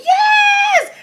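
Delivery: high, drawn-out fan scream; freaking out. Retold or imitated. Fan watching Bobby get his turn, freaking out. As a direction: shriek it out like a fan losing it. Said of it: "I was freaking out".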